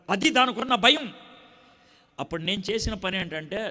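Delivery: ordinary delivery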